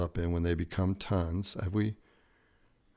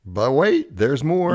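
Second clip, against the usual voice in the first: infomercial pitch; 6 semitones higher, 7 dB louder, slower than usual. Acted. Infomercial catchphrase.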